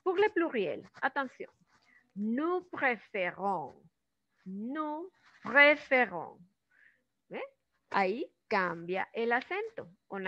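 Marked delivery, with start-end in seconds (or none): 2.1-6.4 slow "Nous préférons", the -ons fully sounded; final ending pronounced. Shown as a demonstration. Teacher modelling for students, "préférons" slow with sounded ending. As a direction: draw out each syllable of "préférons" through the ending. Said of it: "porque estamos pronunciando la terminación final".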